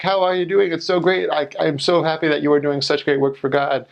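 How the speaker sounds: high, sugary praise; gushing church lady. Retold or imitated. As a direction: beam at them, way up high. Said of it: "and like".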